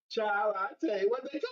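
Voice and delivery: lightly voiced old grandmother; a hint of a church grandma; impersonating Grandma. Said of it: "impersonate Grandma".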